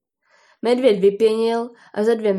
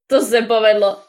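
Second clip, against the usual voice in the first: loud, laughing exclamation; hearty bear tickled pink; amused bear; 5 dB louder than usual. A narrator's reading. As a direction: crow with mirth at a good joke. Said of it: "až se huňáč dosměje".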